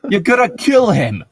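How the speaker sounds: high panicked yell; boy yelling. Acted. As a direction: yell it in a frightened boy's voice. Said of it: "one boy yells".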